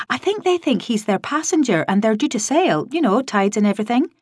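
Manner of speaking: put-on breezy young woman dodging blame; a hint of a scatterbrained colleague; lightly voiced cheerful Sykes. A narrator's reading.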